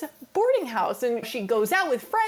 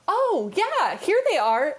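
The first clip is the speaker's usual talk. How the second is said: cheery Nellie; 7 semitones higher, slower than usual. Retold or imitated. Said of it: "my great-great-grandmother goes".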